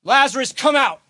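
high, loud call into the tomb. A narrator's reading. Jesus to dead Lazarus, a high, loud command. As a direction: call him out of the grave. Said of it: "he cried out in a loud voice".

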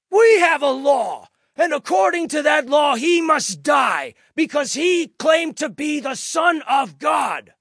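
high, loud, insistent shout; crowd shouting back. A narrator's reading. The priests accusing Jesus to Pilate, insistent shout. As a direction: hammer out the accusation at full volume.

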